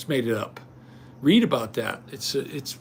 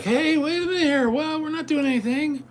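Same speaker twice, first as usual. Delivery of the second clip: high, put-on nervous voice; imitating scared bystanders; 11 semitones higher than usual. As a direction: play the cornered people pleading. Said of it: "they were like".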